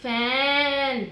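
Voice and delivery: फैन with a wide open vowel, stretched slow; slow wide-mouthed फैन; big open mouth. Shown as a demonstration. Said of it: "तो आपने big mouth open करना है".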